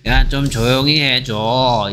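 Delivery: slow, restrained request; milder shushing. Acted. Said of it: "그 정도까지는 아니고 도원이 이렇게".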